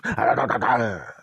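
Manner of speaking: quick trailing string of barks; imitated dog barking. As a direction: bark like a dog giving chase. Imitates dog chasing idiot.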